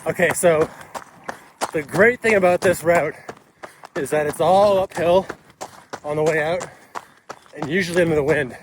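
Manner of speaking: talking while running, loud and high. Real, not acted. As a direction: speak mid-stride, pushing over wind. Talking mid-run, mile seven.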